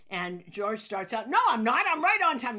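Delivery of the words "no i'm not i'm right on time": higher, louder mock indignant protest; retold protest. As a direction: fire back defensively at being called late. George snaps back, then yelling.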